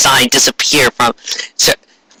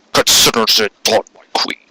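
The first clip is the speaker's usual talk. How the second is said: devoted Mercury; 3 semitones lower than usual. Acted. Mercury accepts mission.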